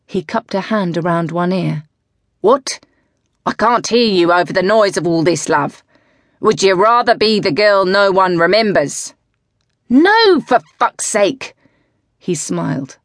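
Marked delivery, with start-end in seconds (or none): 2.4-9.1 louder, mocking hand-to-ear taunt; goading call. Coach goading her loudly. 9.9-11.5 much higher, loud cry; exasperated outburst. Acted. Zoe bursts out in answer.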